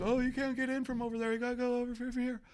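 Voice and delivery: a light Boy Scout impression. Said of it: "the Boy Scouts are like".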